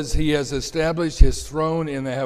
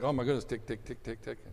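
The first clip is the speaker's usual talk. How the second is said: impatient ticking; 2 semitones lower, 10 dB quieter, faster than usual. Retold or imitated. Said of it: "It's like".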